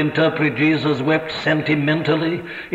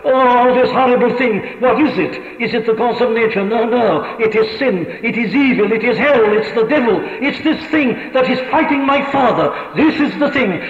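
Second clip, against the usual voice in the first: impassioned outcry; 6 semitones higher than usual. Retold or imitated. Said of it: "He says".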